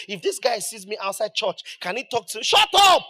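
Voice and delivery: builds to a sudden shouted shut up; huffy churchgoer ending in a yell; mock indignant outburst. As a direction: play the offended member snapping back.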